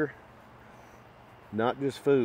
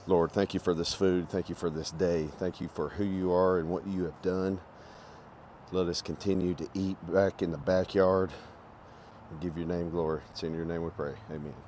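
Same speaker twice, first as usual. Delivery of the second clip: prayer; 4 semitones lower, 4 dB quieter than usual. Real, not acted. Says grace before eating.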